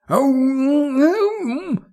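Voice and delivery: high, sustained mouth-full hum; long, high muffled "mm"; muffled moaning. A narrator's reading. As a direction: sound words through a blocked mouth. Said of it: "произнесла Марго, не вынимая член изо рта".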